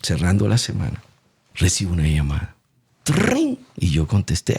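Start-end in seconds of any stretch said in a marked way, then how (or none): 3.0-3.6 high chirped trin of a ringing phone; phone ring imitation. Telling of the fateful call, imitating a high ringing phone.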